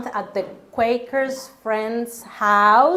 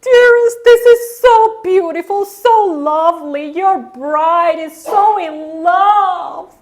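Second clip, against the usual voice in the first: much higher, loud, slow gushing sing-song; moved lady judge; 8 semitones higher, 7 dB louder, slower than usual. Retold or imitated. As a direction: coo like a deeply touched judge. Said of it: "she said".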